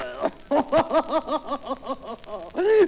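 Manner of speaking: long, high staccato laugh; rire grivois. Acted. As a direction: let out a rising, hammed-up giggle. Rire du marié en action. (long rire saccadé et aigu)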